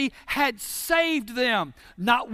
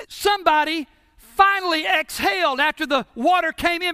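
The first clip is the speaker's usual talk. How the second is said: ordinary talk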